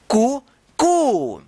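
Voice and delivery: "कु, कु" पहले छोटा फिर लंबा, धीरे; "कु" को दो बार, दूसरी बार खींचकर; short and long u. Shown as a demonstration. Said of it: "इसके उच्चारण में कम समय लगेगा लेकिन इसके उच्चारण में इससे थोड़ा ज्यादा उच्चारण लंबा करेंगे". (छोटा कु फिर लंबा कू)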